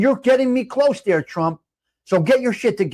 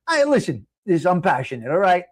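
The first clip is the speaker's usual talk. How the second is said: ordinary talk, nothing marked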